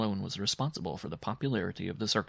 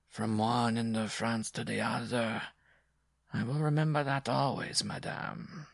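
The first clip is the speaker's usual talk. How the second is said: slow, drawn-out cold repetition; monotonous drone; 3 semitones higher than usual. A narrator's reading. Humiliated dwarf vowing to remember, slow drone. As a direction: drone it slowly, nursing a grudge. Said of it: "he droned in a monotonous undertone".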